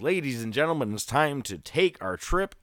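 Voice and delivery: booming host voice rolling into the next segment; announcer intro. Acted. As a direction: hype the segment like a ring announcer.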